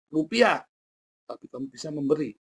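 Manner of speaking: ordinary delivery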